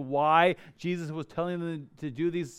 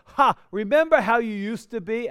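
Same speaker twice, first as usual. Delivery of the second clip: mocking jeer; 5 semitones higher, 7 dB louder than usual. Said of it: "he's not saying these things to go".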